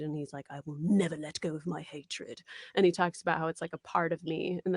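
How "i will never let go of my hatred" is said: put-on soft, brooding boy character voice; quietly resolute character line. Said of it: "one of my favorite lines of his".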